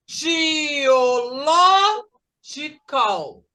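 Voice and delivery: "geological" very slowly, rising into a long LO; drawn-out stressed syllable. Shown as a demonstration. Teacher marking stress for class, "geological" stretched up to LO. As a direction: stretch "geological" up to LO, pause, then drop. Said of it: "olha como é que eu levantei a mão".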